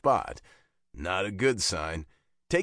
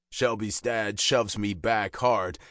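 ordinary delivery

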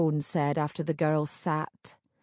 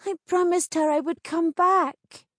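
put-on young woman voice; 11 semitones higher, 3 dB louder than usual. Heroine's tearful vow.

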